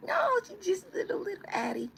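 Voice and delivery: very high squeaky cooing; mock baby-talk squeal.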